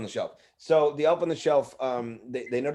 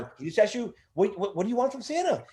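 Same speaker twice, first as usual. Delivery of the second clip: elf Chip; 9 semitones higher than usual. Retold or imitated. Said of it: "I would just always prompt them".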